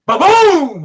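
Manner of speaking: high, drawn-out "ba-boom"; imitated explosion boom. Storyteller imitates the gas-soaked car blowing up, big boom. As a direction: boom it out like a blast.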